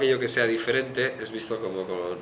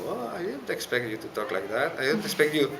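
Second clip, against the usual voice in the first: higher, put-on surprised American voice in English; mock surprised American; 8 semitones higher than usual. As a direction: imitate the startled local who hears an accent. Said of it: "Porque es como".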